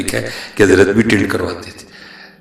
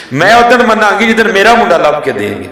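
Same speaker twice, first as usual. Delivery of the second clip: higher, louder and strained as she repeats it; wife's outburst; 10 semitones higher, 5 dB louder than usual. Retold or imitated. Preacher voicing the wife's second refusal, now bursting out. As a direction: let it burst, stressing اُدن. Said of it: "بی بی پھٹ پڑی".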